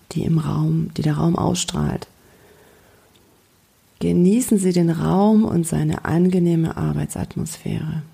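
guided meditation. Acted. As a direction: gently lead a daydream. Guides a calm visualization. (ruhige Fantasiereise-Stimme)

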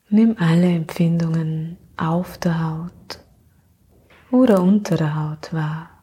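sleep meditation. Acted. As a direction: gently lull her into sleep.

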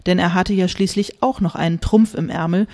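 ordinary delivery

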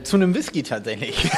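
normal talking voice

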